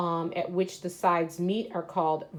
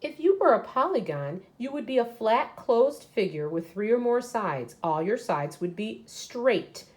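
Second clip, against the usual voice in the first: higher, louder, brisk storybook reading; reading aloud to children; 5 semitones higher, 5 dB louder than usual. Acted. Teacher reading a picture book to her class, lively read-aloud. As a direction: read it out big and lively for the kids.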